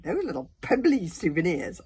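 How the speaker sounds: loud, scornful sarcasm; sarcastic. A narrator's reading. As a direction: belittle them with mock admiration. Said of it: "said Fishlegs sarcastically".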